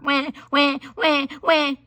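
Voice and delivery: high wah wah crying; baby crying. Acted. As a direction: wail like a newborn far off. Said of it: "she heard".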